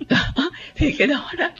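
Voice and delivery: words breaking up into laughter; laughing. Real, not acted. Co-host laughs after mimicking strict parents, giggly and high. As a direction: talk through high-pitched giggles.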